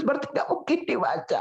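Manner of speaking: ordinary talk, nothing marked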